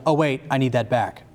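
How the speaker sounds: usual speaking voice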